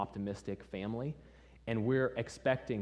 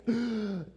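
loud, high-pitched gasp for breath; sharp imitated revival gasp; sudden gasp; 12 semitones higher, 5 dB louder than usual. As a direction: gasp as if jolted back to consciousness. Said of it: "gasps this breath".

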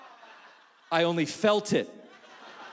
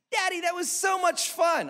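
put-on much higher, gushing child; delighted little girl; 13 semitones higher than usual. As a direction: squeal it with joy. Daughters after the slide.

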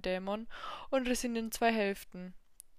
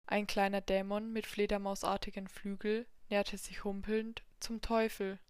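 plain reading voice

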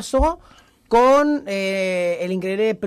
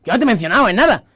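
fast, louder retort, a little annoyed; a bit angry; 2 semitones higher, 7 dB louder, faster than usual. Retold or imitated. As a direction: snap back quickly, mildly offended. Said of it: "un poco enfadado incluso".

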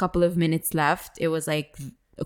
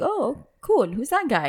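higher, bright-eyed curiosity; intrigued; 7 semitones higher than usual. Retold or imitated. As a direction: perk up at the guy on stage. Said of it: "I was like".

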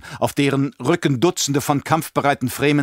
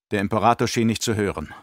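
ordinary delivery

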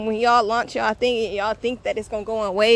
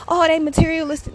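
higher, louder scoffing voice; mock accuser; 7 semitones higher, 3 dB louder than usual. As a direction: sneer it up high like a gossip. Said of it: "it's like".

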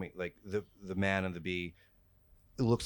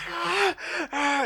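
high distorted monster shriek; 10 dB louder than usual. Acted. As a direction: let out a piercing creature shriek.

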